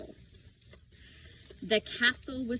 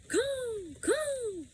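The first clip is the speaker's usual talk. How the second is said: much higher caw, caw; raven call. A narrator's reading. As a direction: call out like a raven thanking her. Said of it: "he called".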